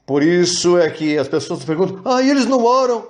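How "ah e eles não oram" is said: mock incredulous question. Mocking critics who ask about prayer.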